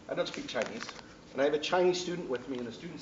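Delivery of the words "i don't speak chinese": ordinary talk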